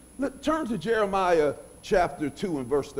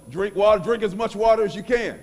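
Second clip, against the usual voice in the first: mildly raised, insistent coaching voice; urging coach; 5 semitones higher, 6 dB louder than usual. Retold or imitated. Today's coaches telling players to stay hydrated, urging. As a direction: urge it like a coach, a bit louder.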